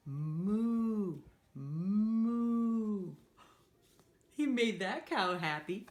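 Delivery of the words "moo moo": slow, drawn-out mooing like a cow. Acted. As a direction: stretch it out like a cow. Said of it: "He can sound like a cow".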